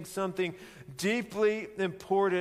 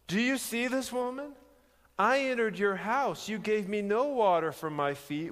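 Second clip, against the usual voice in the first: confronting Simon; 6 semitones higher than usual. Acted.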